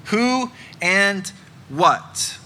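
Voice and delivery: who and what drawn out slowly, falling; slow emphasis. Shown as a demonstration. Preacher spelling out lament questions, slow. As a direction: let each word land, very slowly. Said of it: "the first two questions are who and what".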